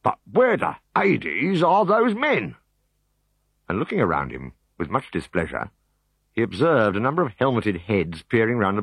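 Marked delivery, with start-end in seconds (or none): 0.0-2.5 high, slow, annoyed officer. Acted. Decurion looking for his legionaries, slow and annoyed. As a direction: demand where his men went.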